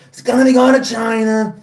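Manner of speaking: amateur impersonation, fading at the end; Donald Trump. Acted. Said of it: "He has a great Trump impression".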